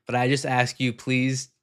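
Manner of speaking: usual speaking voice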